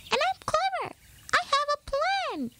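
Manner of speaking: high, firm mouse voice; insistent little mouse. A narrator's reading. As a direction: insist bravely, squeaky. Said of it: "insisted Pip".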